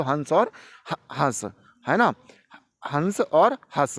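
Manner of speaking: हंस slowly with a nasal vowel, then हंस with a plain open vowel; drawn-out contrast of हंस and हंस; contrast demo. Shown as a demonstration. Teacher to students, slowly contrasting हंस and हंस. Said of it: "दोनों उदाहरण याद कर लो".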